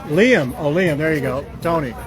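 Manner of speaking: ordinary talk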